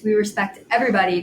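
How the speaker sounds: ordinary talk, nothing marked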